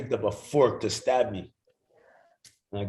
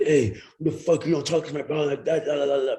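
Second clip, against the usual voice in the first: hammed-up brothers sticking up for him; imitating angry brothers; 6 semitones higher, faster than usual. Brothers yelling at the band member, mock angry.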